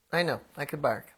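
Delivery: ordinary delivery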